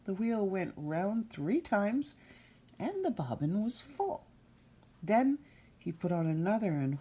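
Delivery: put-on reading a fairy tale to children, unhurried; storyteller voice for a child listener; story narration. A narrator's reading. Reader telling Rumpelstiltskin to a child.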